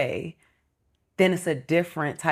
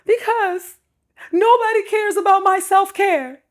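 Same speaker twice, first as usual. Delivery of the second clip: much higher, louder whiny voice; imitating friend; 5 dB louder than usual. Woman mimics her friend's complaint about self-care, high and whiny. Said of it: "And I was like, "Why?".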